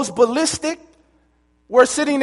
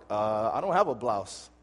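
timid recruit; 13 semitones lower, 11 dB quieter than usual. Retold or imitated.

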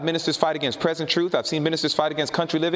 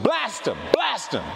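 emphatic, higher-pitched outburst on each blast; blasting them; 5 semitones higher, slower than usual. Retold or imitated. Preacher recalling how he rebuked elders, blasting. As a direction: punch out blast them like an attack. Demonstrating how he used to attack.

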